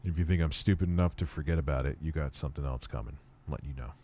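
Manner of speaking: hushed low threat. Pretend. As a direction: hiss the warning low.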